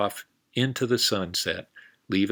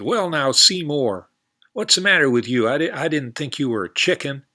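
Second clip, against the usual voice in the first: higher, louder cowboy scolding his horse; loud, higher cowboy puzzled at his horse; 6 semitones higher, 8 dB louder than usual. Acted.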